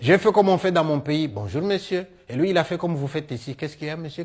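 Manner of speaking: usual speaking voice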